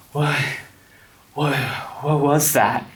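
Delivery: panting shock after the run; out of breath, scared. Acted. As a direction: gasp it out, shaken. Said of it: "they're both kind of out of breath".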